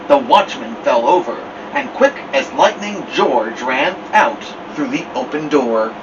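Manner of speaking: plain reading voice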